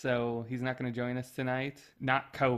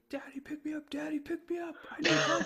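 put-on high whiny child's plea, much higher; toddler screaming; 12 semitones higher, faster than usual. Son begging dad to pick him up, high whiny child voice. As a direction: whine like a small boy wanting up. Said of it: "he was just screaming at me".

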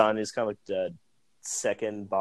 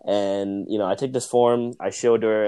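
ordinary talk

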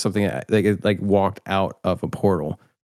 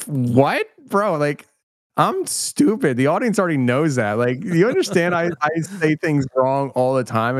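incredulous reply; 7 semitones higher than usual. Retold or imitated. Telling the guests how he answered his brother, high incredulous scoff. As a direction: scoff at the offer in a high, disbelieving voice.

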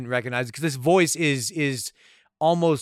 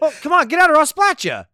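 high, loud put-on goon voice; hammed-up insistent threat; mock thug threat; 11 semitones higher, 6 dB louder than usual. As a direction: badger them in a pitched-up, exasperated henchman voice. Acts out insistent thug threatening driver.

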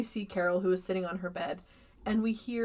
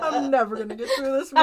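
loud, higher, quick words through laughter; real laughing fit; 5 semitones higher, 9 dB louder, faster than usual. Real, not acted. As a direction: despair of finishing while cracking up. Cracking up while retelling.

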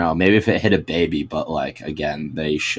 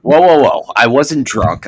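loud protest; 7 semitones higher, 9 dB louder than usual. Pretend. Mock defendant protesting in court.

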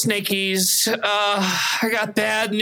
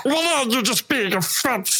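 gremlin voice; 4 semitones higher than usual. Acted. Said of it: "sound like a gremlin".

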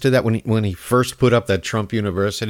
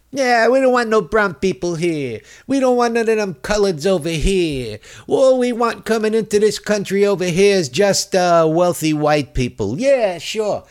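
higher-pitched mocking bigot voice; 7 semitones higher than usual. Mocking the immigration director's views, mock bigot voice. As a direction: play the xenophobe, high and sarcastic.

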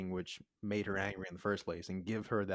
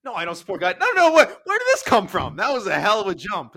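high, loud, flustered denial; loud indignant protest; 10 semitones higher, 13 dB louder than usual. Real, not acted. Host denies supporting guys who punch women, indignant. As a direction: shout it in disbelief at the accusation.

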